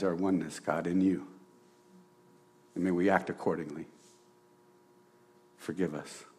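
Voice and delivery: heartfelt spoken prayer, softening. Real, not acted. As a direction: pray aloud, sincere and hushed.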